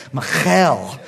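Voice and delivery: "Michal" drawn out with a throaty kh; exaggerated Hebrew accent on "Michal"; Hebrew pronunciation. Shown as a demonstration. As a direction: stretch "Michal" out as mi-KHAAL, Hebrew style. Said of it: "say it with a Hebrew accent".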